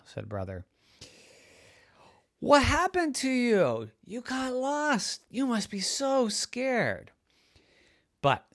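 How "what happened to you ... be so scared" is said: fussing parent. Acted. Parents fuss over found child.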